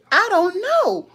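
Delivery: high, squeaky, hammed-up cluelessness; mock innocence. Retelling her answer to her son, mock innocent. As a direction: play dumb in a high voice.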